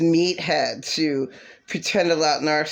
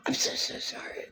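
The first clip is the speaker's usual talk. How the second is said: breathy whispered fake sorry; mock whisper; 11 dB quieter than usual. Mimicking the ex's whispered apology. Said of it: "worst bullshit fucking apology ever".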